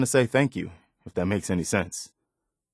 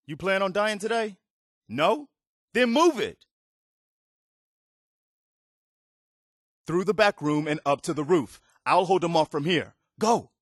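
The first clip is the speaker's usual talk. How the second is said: yelling commands over gunfire; urgent shouting; 8 semitones higher than usual. Acted. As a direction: bark them out under fire. Urgent order during raider attack.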